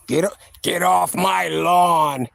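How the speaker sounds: put-on slow gruff-old-man line; mild Clint Eastwood imitation. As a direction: growl it like a crotchety old man. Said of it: "Like Clint Eastwood".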